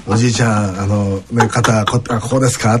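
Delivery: playing a sly salesman who flatters a grandpa; smooth salesman to grandpa. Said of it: "とかって".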